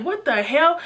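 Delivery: high-pitched indignant outburst; acted-out baffled protest; exasperated disbelief. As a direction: throw it out in retold outrage. Acted disbelief at being chased.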